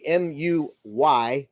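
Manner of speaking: slow, careful letter-by-letter spelling; each letter of M U Y given slowly and separately. Shown as a demonstration. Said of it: "I've always used muy".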